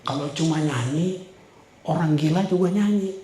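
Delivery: soft inner voice. Retold or imitated. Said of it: "Dia bilang gini".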